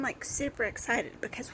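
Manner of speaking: normal talking voice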